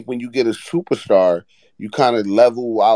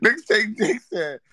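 laughing through words; 3 semitones higher, 3 dB quieter than usual. Real, not acted. Host retells while laughing.

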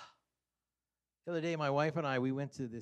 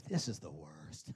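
low defeated groan; 9 semitones lower, 6 dB quieter than usual. Retold or imitated. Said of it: "you see yourself".

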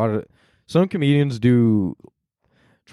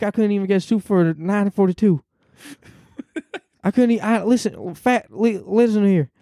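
put-on high, much higher old man Biden voice; high mock Biden rant about soup; Joe Biden impression; 11 semitones higher than usual. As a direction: scold the voter like Biden at a town hall. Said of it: "a solid Joe Biden".

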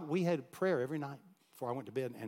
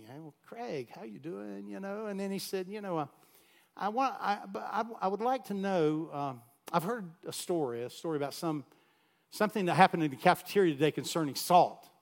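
a hint of a friendly principal, higher; coaxing the boy gently, higher voice; principal's voice; 6 semitones higher, 3 dB louder than usual. Principal gently probing the boy about the salt, higher.

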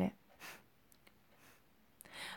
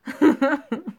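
loud high laugh; hearty laugh; 6 semitones higher, 7 dB louder than usual. Real, not acted. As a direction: burst out laughing. Laughing at own joke. (громкий смех)

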